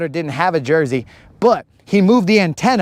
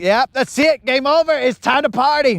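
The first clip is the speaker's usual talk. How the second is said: higher, hyped-up celebration; mock party cheer; 8 semitones higher than usual. Retelling Colin declaring game over, higher mock cheer. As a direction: toss it off like the game is won. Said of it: "he's like".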